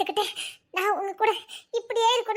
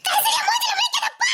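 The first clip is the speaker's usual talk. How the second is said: much higher, louder screaming; hysterical shout; 12 semitones higher, 4 dB louder than usual. Acted. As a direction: lash out near breaking. Wife screaming at husband. (வெறித்தனமான கத்தல்)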